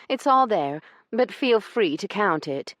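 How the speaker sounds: a cutting little jab; snide. Acted. Io needling Branson as he reaches for the money, snide. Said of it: "her snide words".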